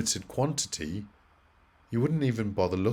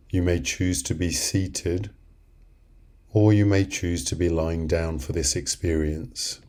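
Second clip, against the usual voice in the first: guided meditation; 3 semitones lower than usual. Acted.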